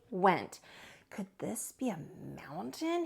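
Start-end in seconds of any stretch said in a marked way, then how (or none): 1.1-3.1 breathless awed whisper. Acted. Bear wondering to himself, whispered. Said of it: "he wondered".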